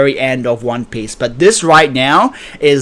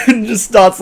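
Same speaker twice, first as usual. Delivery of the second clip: hearty laugh; 7 semitones higher than usual. Real, not acted. Laughing at the manga scene.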